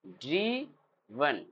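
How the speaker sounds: ड्रीवन said very slowly, short i; drawn out pronunciation. Shown as a demonstration. Teacher to class, ड्रीवन slowly drawn out. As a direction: stretch ड्रीवन out slowly for students. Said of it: "ड्राइवन नहीं पढ़ेंगे".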